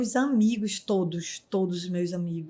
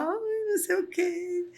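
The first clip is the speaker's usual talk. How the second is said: mock crying; 10 semitones higher than usual. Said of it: "começou a chorar".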